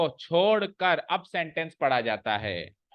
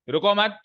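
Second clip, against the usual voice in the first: रुको मत run together, higher and louder; pause after मत; 5 semitones higher, 5 dB louder than usual. Shown as a demonstration. Teacher placing the comma, रुको मत as one phrase. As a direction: push रुको मत up as one unit, then stop. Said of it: "यहां placing कर दूं".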